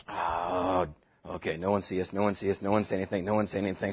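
low, hushed sneaking mumble; sneaky hush. Acted. Disciples sneaking off with a stolen donkey, hushed and low. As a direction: creep away murmuring, hoping nobody notices.

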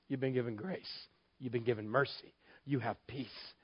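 a quiet voice inside your head; soft inner voice. Retold or imitated. Voice of God in his head.

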